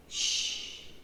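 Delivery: long voiceless shush; hushing. A narrator's reading. Mum quiets the searching children, hushing. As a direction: shush them gently.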